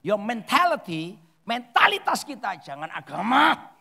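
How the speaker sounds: slow, loud exhortation; emphatic preaching. Real, not acted. Preacher urging a change from religious mentality, loud and emphatic. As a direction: drive it home loudly, stretching words. Preacher hammers first principle home.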